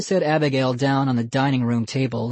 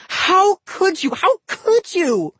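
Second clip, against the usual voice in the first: much higher, louder, slow wail; 5 dB louder, slower than usual. A narrator's reading. Molly wails at her brother over the doll, slow and high. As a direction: accuse him through tears. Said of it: "she wailed".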